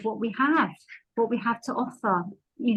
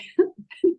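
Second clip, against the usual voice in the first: embarrassed laugh through tears; a small laugh, fighting back tears; teary laugh; 9 semitones higher, 4 dB louder than usual. Real, not acted. Teary laugh after emotional share.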